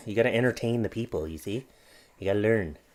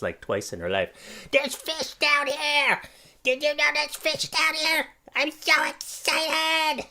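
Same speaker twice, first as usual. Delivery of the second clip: put-on baby's voice; 10 semitones higher than usual. Dad voicing his baby daughter, very high squeaky excited voice. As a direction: voice the baby, thrilled and tiny. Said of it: "your voice is a little bit like a gremlin".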